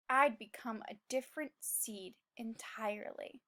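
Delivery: hushed low whisper; ominous whisper. A narrator's reading. Seed confessing he's become bad, eerie whisper. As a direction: whisper the dark change. Dark turning point in story.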